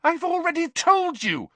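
very high, loud exasperation; very angry. A narrator's reading. Hermione repeats herself to pushy Ron, very angrily. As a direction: let the temper boil over. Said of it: "Hermione said very angrily".

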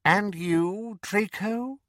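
high, cold, silky menace; sinister dark lord; Voldemort voice. Acted. Voldemort questions Draco.

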